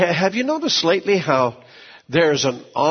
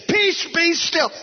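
slow, much higher, drawn-out command; commanding the wind; 13 semitones higher, slower than usual. Retold or imitated. Pastor rebuking the wind a second time, slow high command. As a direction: order the storm to stop. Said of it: "I meant it with everything in me".

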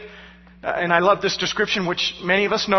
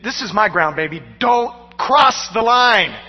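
slow, louder defiant warning; defiant claim; 5 dB louder, slower than usual. Pretend. Pastor voicing a believer holding ground, slow and defiant. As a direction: plant your feet and warn them off. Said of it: "it's almost like".

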